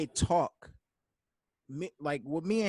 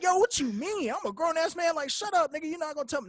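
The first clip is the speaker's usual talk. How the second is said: much higher, louder, mock outraged protest; indignant mock protest; 11 semitones higher, 3 dB louder than usual. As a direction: play your own indignant younger self, high. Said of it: "I'm like".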